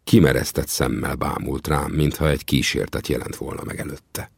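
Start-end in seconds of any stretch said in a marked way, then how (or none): none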